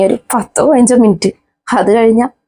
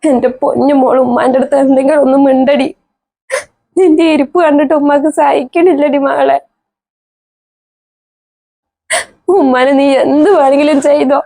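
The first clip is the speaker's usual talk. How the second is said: tearful pleading; 4 semitones higher than usual. Acted. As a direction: beg her daughter to speak. Mother pleading with silent daughter. (കുറ്റബോധത്തോടെ കരഞ്ഞ് യാചന)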